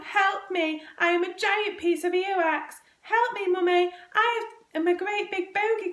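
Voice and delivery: high-pitched, hammed-up boyish wail; tearful wailing. A narrator's reading. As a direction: plead tearfully for mother's help. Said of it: "burst into tears".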